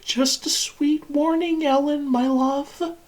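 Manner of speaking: very high, cooing endearment; sing-song false sweetness; saccharine mockery. Machine warns captive as "my love", saccharine mockery.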